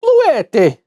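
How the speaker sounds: "Bluetti" said with a high, mock Italian lilt like spaghetti; hammed-up Italian accent on "Bluetti". Shown as a demonstration. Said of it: "I wanna say it with an Italian accent".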